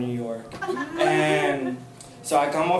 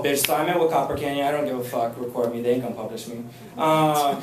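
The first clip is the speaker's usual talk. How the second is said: ordinary talk, nothing marked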